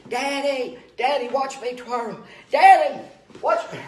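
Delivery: put-on high, eager child voice; little boy calling. Little boy twirling a baton in the yard, calling to his daddy, high and eager. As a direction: call out like an excited little kid. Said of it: "little son calling to Daddy".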